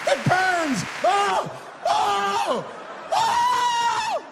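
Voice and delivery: high, loud mock agony screams; hammed-up escalating howls of pain; freaking out. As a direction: shriek as if scalded. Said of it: "This guy was freaking out".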